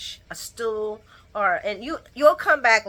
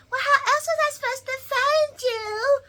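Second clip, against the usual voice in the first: high, whimpering mock cry; mock whiny Eden. Mocking Eden's excuse, high whimpering voice. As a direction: whine it like a teary girl.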